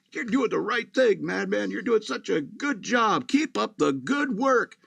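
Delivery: sing-song upbeat assistant greeting you; relentlessly perky machine voice; cheerful robot voice. Retold or imitated. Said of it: "cheerful voice just in the background".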